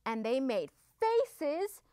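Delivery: ordinary delivery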